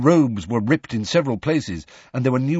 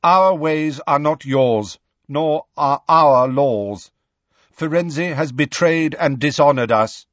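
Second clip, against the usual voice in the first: proud centaur, louder, slow; solemn centaur, slow and loud; 6 semitones higher, 5 dB louder than usual. Acted. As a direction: lay down the law slowly. Centaur declares their laws.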